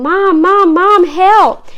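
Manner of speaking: loud, high repeated cries for help; screamed. A narrator's reading. Hurt boy screams to his mother for help. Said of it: "Nick screamed".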